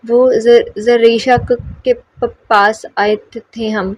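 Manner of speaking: slow, broken stammer; girl stammering to a stranger; stuttering. Acted. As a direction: get stuck on each word's first sound. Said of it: "وہ بولتی ہی اس طرح".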